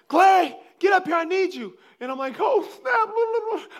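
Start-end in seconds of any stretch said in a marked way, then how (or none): 0.1-1.8 high, loud summoning shout; imitated calling out. Daughter calling her dad to come up, high shout. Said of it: "Regina called me". 2.4-3.7 high, loud exclamation sliding into babble; imitated tongue-tied speech. Man who can't talk when called, high garbled blurt. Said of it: "I can't talk right".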